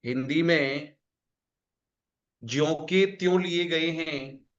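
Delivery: slow dictation, each word spaced; slow it down for note-taking; dictation pace. Shown as a demonstration. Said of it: "लिखो".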